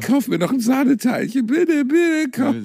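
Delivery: whiny begging. Pretend. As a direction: whine like a kid for sweets.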